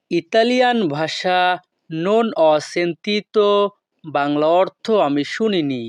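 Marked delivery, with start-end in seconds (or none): 1.9-3.5 "non ho sentito" said slowly, word by word; slow repeat. Shown as a demonstration. Teacher repeating for students, slow and careful.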